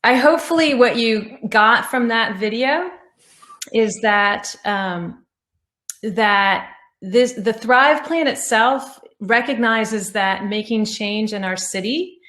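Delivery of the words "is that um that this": ordinary delivery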